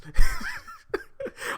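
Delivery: loud, high burst of laughter; laughing. Real, not acted. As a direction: crack up at your own impression. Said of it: "here's why I'm laughing".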